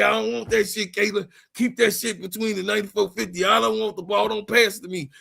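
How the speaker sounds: lightly voiced annoyed referee; a hint of a fed-up referee. Referee refuses the ball from Caitlin, mildly annoyed. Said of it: "Referee say".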